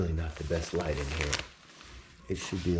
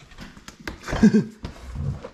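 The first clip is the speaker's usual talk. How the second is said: high breathy laugh; 14 semitones higher, 5 dB louder than usual. Real, not acted. Laughing at stuck box.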